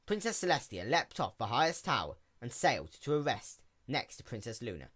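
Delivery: ordinary delivery, nothing marked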